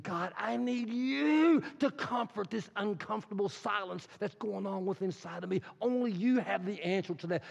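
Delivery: heartfelt mock prayer; pleading prayer. Voicing a prayer to God.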